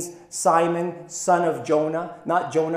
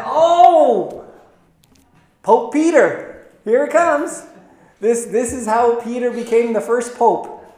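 much higher and louder, hammed-up excitement; mocking excited believers; 6 semitones higher, 6 dB louder than usual. Preacher mocking those who read papacy into the verse, hammed-up. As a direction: lampoon the gleeful claim. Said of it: "we know people have said".

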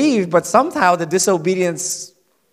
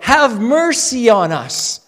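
crying out; 6 semitones higher, 4 dB louder, slower than usual. A narrator's reading. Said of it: "they cry out".

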